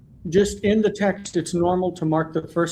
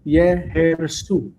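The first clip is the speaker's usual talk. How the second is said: "Yehersu" very slow, each syllable set apart; secondary stress; much slower than usual. Shown as a demonstration. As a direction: stretch "Yehersu", giving "Ye" its own stress. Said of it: "for secondary stress".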